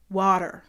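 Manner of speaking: "water" as WAH-der, D and R sounded; Canadian water. Shown as a demonstration. Showing how Canadians say it, D-sound "water". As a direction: swap the T in water for a D. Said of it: "with a D".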